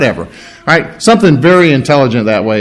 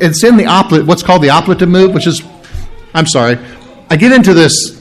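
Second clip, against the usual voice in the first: ordinary talk, nothing marked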